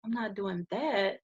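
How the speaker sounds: soft, slow, weak refusal. Retold or imitated. Patient refuses a transfusion, softly and slowly. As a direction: turn it down with almost no breath. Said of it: "this is exactly how I'm talking".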